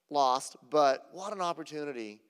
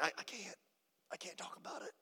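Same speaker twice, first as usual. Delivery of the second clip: choked whisper; 6 semitones higher, 12 dB quieter than usual. Retold or imitated. Retelling employee too moved to speak.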